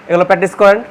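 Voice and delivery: normal talking voice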